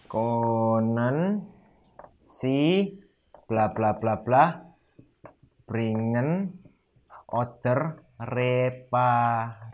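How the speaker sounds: slow halting model. Shown as a demonstration. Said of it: "teacher models request slowly, halting".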